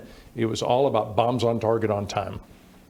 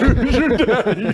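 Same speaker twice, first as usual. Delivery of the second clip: laughing triumphant boast; 13 semitones higher, 8 dB louder than usual. Pretend. Guest crowing over his win, laughing loud. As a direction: shout it high and loud through a laugh.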